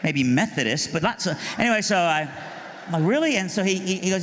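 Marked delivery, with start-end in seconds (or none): 3.0-3.4 disbelief. Retold or imitated. Still disbelieving buddy goes to church.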